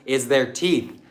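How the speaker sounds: usual speaking voice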